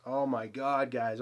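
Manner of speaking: normal talking voice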